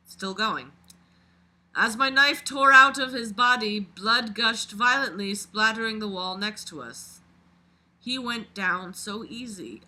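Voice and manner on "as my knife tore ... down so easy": reading a dark first-person story aloud, a bit louder; dramatic read-aloud of a creepy story. A narrator's reading. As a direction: narrate it like a confession being read out. Reads the killer's story aloud.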